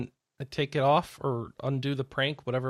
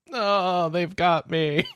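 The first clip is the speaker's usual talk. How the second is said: hammed-up dying cat, louder; overacted death scene; 4 semitones higher, 5 dB louder, much slower than usual. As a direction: melodramatic defeat cry. Cat dramatically dying under tape.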